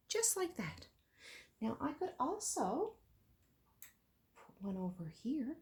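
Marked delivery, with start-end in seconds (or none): 4.3-5.6 whispered aside. Real, not acted. Teacher murmuring to herself while arranging tags, whispered. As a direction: whisper it while you place the piece.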